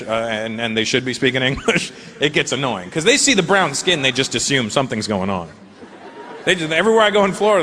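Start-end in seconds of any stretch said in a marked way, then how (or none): none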